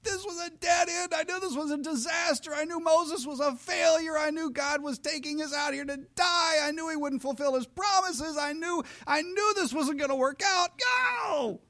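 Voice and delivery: a high, hammed-up whiny wail; a shrieking mock despair ending in no; whining people. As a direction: wail I knew it like a sulky crowd. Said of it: "And the people went".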